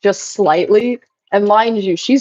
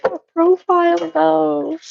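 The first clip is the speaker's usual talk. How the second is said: mock old lady voice; 9 semitones higher, slower than usual. Retold grandma line.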